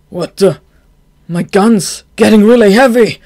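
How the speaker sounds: loud alarmed outburst; shocked. Acted. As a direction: panic as the gun grows heavy. Gunman shocked by heavy gun.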